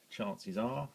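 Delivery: ordinary talk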